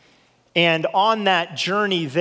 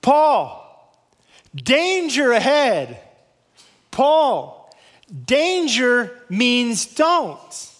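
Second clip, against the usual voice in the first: urgent warning; 5 semitones higher, much slower than usual. Retold or imitated. Believers warning Paul, slow urgent higher plea. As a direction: spell out the danger as if begging him to stop.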